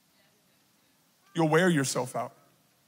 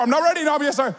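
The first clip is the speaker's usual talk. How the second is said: whiny protest; 8 semitones higher, faster than usual. Pretend. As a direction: beg off like a kid. He argues with God.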